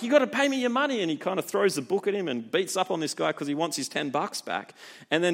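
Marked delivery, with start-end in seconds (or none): 0.0-1.0 much higher, louder hammed-up thug; mock angry demand. Preacher voicing the servant bullying his debtor, mock angry. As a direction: shake him down for the money, mock fury.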